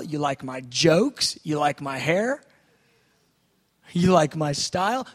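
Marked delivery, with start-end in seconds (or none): none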